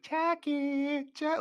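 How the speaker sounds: high, put-on child's call; imitated kid calling out. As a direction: call for a lost friend like a kid.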